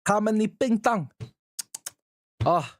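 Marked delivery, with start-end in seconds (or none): 0.1-1.1 mock Chinese. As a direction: repeat the fake Chinese, pushed harder. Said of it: "sound like you're just making fun of Chinese".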